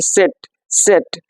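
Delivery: "सेट" as tset, a light t before the s; light t sound. Shown as a demonstration. Teacher shows German Z to students, light t sound. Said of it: "हल्का सा टॉ का sound".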